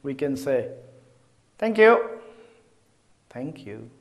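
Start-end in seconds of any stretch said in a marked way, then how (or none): 1.6-2.2 louder, higher, cheerful "Thank you"; delighted thank you for a gift; bright thank you. Shown as a demonstration. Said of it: "there's a different emotion behind it". 3.3-3.9 much softer, lower, falling "Thank you"; a subdued, dutiful thank you; quiet thank you. Shown as a demonstration. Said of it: "there's a different emotion behind it".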